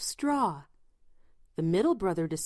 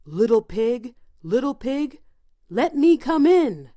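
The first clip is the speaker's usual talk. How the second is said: loud wolf call, rising to a shout; wolf crying out; 4 semitones higher, 7 dB louder than usual. A narrator's reading. Wolf at the first pig's slammed door, crying out to get in. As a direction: call out as the big bad wolf, louder on the last demand. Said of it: "cried the wolf".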